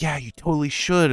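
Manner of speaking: high, enthusiastic urging; retold eager encouragement. Woman urging speaker toward roleplay, high and eager. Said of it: "lit up like a light bulb".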